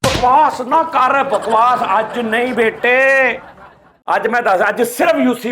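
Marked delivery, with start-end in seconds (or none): 0.1-3.4 very slow, stretched-out telling-off; drawn-out scolding. Acted. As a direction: drag each word out to silence them. Mock scolding, silencing the others. (ਖਿੱਚ ਖਿੱਚ ਕੇ ਝਿੜਕਣਾ)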